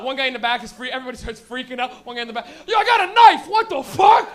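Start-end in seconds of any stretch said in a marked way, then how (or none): 2.7-4.3 loud, much higher yelp of panic; panicked shout. Retold or imitated. Kid in the back panicking about his knife, loud yelp. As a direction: blurt it out in terror.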